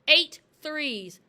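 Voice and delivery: high, loud drilling shout. A narrator's reading. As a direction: shout the sum at the pupil. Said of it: "the Trunchbull shouted".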